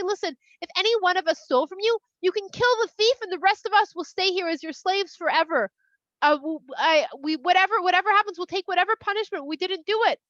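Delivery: high-pitched acted protest of innocence; urgent protesting. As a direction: insist desperately, as a storyteller playing accused brothers. Brothers protest their innocence to Yosef.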